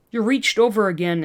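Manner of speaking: usual speaking voice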